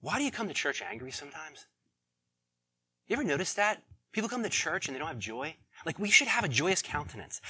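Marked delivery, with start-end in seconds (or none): none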